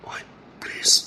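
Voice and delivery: soft unvoiced please; breathy whispered please. Real, not acted. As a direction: beg in a whisper. Pleading softly.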